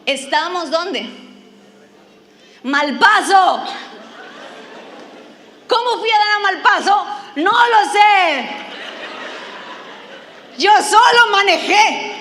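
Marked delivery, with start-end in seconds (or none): 2.6-12.1 loud, higher shouting, slowing down; alarmed disbelief. Retold or imitated. She realizes she drove to Malpaso: loud laughing alarm.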